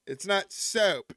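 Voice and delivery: soap stretched slow toward "saoup" in a mock Southern accent. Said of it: "that's how people down south in Tennessee talk".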